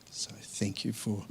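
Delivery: the voice gives out as he thanks them; a mourner thanking the family, voice failing to a whisper; choked whisper. Real, not acted. Eulogy thanks, emotion overwhelming.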